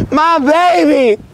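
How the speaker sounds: high, drawn-out wail; real high-pitched lament, words stretched long; distraught wailing. Real, not acted. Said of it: "I'm actually so sad".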